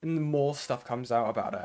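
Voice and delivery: ordinary talk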